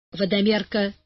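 ordinary delivery, nothing marked

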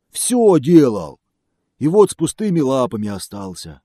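loud, high lamenting bear. Acted. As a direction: list woes like a sorry bear.

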